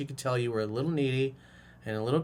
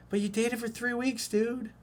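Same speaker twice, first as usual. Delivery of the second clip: incredulous; 10 semitones higher than usual. Real, not acted. Incredulous at the listener.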